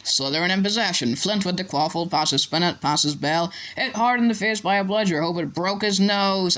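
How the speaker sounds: running play-by-play, rising in pitch; match commentary. A narrator's reading. Commentator calling a bludger hit, partisan commentary. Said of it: "Lee was still commentating".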